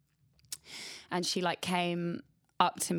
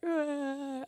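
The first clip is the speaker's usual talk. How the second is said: high sob; 10 semitones higher than usual. Retold or imitated. Wordless cry after being dismissed.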